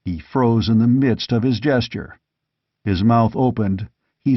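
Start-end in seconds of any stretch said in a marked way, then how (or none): none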